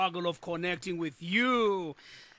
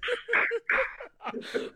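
helpless high laugh; high laughing fit. Real, not acted. Host bursting into laughter at the chant. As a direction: crack up completely.

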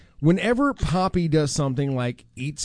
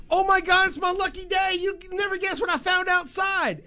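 high, thrilled mock squeal of glee; imitating excited coworker; 12 semitones higher than usual. Host imitates coworker bursting in with her find, mock thrilled. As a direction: play her like she just struck gold. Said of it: "M J comes to my office all excited".

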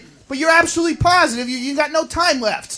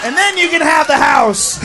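yelling; 4 semitones higher than usual. Acted. Dying man's crude last words.

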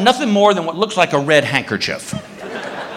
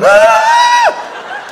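very high, loud scream; screaming as he flees the shark; terrified scream; 5 dB louder than usual. Retold or imitated. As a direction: shriek while running out of the water. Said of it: "screaming the whole way".

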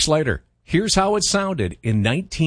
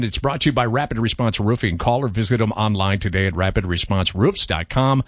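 sponsor ad read; 4 semitones lower than usual. Acted. Reads sponsor ad.

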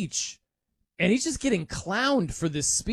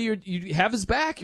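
normal talking voice

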